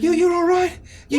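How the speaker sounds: high, loud, anxious mother voice; concerned mother. Acted. Mother making sure her child is safe, concerned. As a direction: check anxiously on your child.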